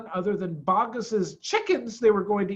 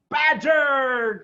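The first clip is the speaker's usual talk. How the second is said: higher and louder, calling out his name; glad cry; 9 semitones higher, 7 dB louder than usual. A narrator's reading. Mister Fox spotting his old friend, glad cry. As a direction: greet him with a happy shout. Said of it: "cried Mister Fox".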